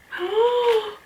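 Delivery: very high, drawn-in gasp; thrilled gasp. Real, not acted. Seeing which tape was sent, thrilled gasp. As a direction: gasp at the big reveal.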